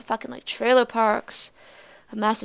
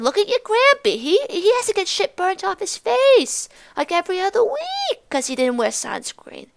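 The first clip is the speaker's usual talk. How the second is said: mock grandma lecture; 8 semitones higher, 4 dB louder than usual. Grandma lecturing about sunscreen.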